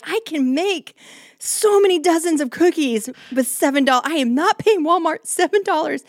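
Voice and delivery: high, loud mock outrage over cookie prices; indignant rant. Wife ranting to husband in Walmart, high indignant outburst. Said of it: "and I'm like".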